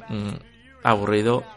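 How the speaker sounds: ordinary delivery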